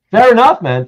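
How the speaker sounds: host laughing off a guest's refusal to choose. Real, not acted.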